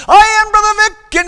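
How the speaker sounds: high, full-volume conference preacher shout; Brother Vic. Retold or imitated. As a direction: holler it as if to a national conference. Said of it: "it was like he was preaching at".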